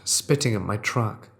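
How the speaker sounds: plain reading voice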